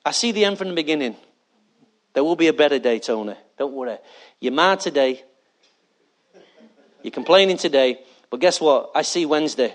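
calm, reassuring, tender; gentle God voice. Acted. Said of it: "And God says".

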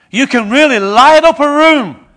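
emphatic, building to a peak; loud burst. Acted. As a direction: blaze it out like a flash of fire. Describing burst of light.

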